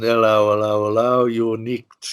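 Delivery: a light Cockney policeman's voice; mock London bobby making an arrest, in Cockney; Cockney copper. Imitating how ex-Met officers talk, light Cockney accent. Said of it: "it's got this sort of Cockney accent".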